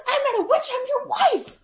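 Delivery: very high screechy retort; high-pitched mock outrage; indignant wife. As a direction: protest shrilly like the screeching wife. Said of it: "She goes".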